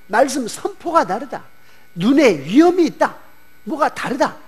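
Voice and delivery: voicing the amazed fishermen on the shore; awed crowd. Crowd marvels at Jesus' authority.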